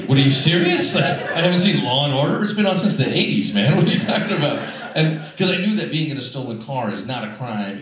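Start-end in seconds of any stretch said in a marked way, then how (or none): 0.1-4.6 louder, higher indignant outburst; incredulous comeback. Retold or imitated. Young suspect challenging the cop's old trick, incredulous. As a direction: fire back in mock disbelief.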